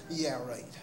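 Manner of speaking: usual speaking voice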